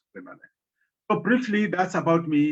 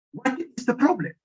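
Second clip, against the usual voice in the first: higher, lofty spirit voice; 9 semitones higher than usual. Acted. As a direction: speak as the summoned ancestor. Ancestor spirit speaks.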